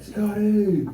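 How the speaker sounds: imitated high-pitched greeting call. Cousin calls out to mistaken stranger.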